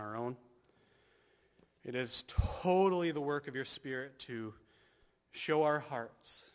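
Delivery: fervent, steady pastoral prayer; earnest prayer. Acted. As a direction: pray over the congregation. Closing prayer.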